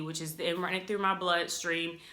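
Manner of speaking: normal talking voice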